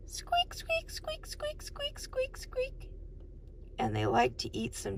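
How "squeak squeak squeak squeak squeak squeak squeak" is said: very high, soft little squeaks; mouse squeaking. Acted. Adult giving a child a mouse clue, tiny high squeaks. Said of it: "something that goes".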